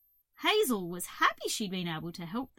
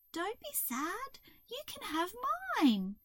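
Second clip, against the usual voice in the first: comforting Hazel; 10 semitones higher than usual. A narrator's reading. Hazel offering the crow her colours, comforting. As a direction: offer it gently, pitched up.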